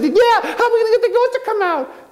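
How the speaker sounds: high dopey echoing voice; copycat Taylor. Acted. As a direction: parrot the question like a sidekick. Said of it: "And Taylor said".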